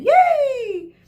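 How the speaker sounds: put-on a shrill kids' cheer. A narrator's reading. Group of children cheering about the water world. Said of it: "Group B cheered again".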